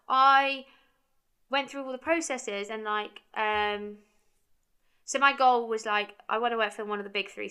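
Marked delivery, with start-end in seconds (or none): none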